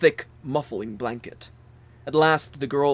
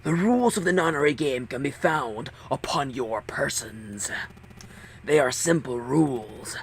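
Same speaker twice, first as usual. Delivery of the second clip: whispered villain orders; cold whisper. Acted. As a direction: hiss the rules coldly.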